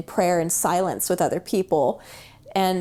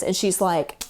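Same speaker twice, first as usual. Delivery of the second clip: ordinary talk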